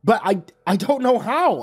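high panicked stammer; frightened cry, high and stammering. Acted. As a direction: plead in rising fear. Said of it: "I cried out".

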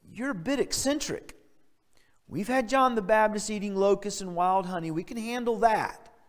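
high-pitched, placating mock plea; acted crowd begging him to tone it down; pleading protest. Acting out people pleading with Christ.

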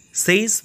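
"Says" with a long AY vowel; wrong SAYS. Shown as a demonstration. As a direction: say "Says" to rhyme with days, pitched high. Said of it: "we will say it's wrong". ("Says" mispronounced as "sayz")

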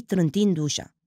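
ordinary delivery

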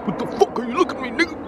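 high-pitched fast talking-dog voice; dog's imagined voice. Acted. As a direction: rattle it off high, as the indignant dog. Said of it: "the dog is like".

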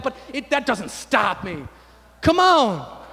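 loud, high, impassioned sermon; full-force preaching. Real, not acted. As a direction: proclaim it to rouse the church.

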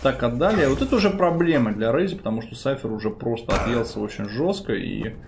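ordinary talk

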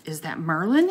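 plain reading voice

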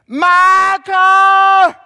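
loud high call across the yard; mother yelling for her kid from the porch; mama calling out. Retold or imitated. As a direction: holler the name like a mother from the porch. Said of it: "She'd stand out on the porch".

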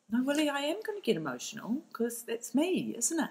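lightly acted, high-pitched insistence; playful protest. Client insisting to her lawyer she will get emotional, mock protest.

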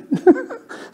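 a sudden high burst of laughter. Real, not acted. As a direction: laugh at your own fib. Laughs at his own bluff.

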